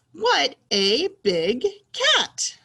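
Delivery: excited reading. Shown as a demonstration. Teacher reading about the cat for pupils, excited. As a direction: exclaim it word by word, punching the last. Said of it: "say it in an excited way".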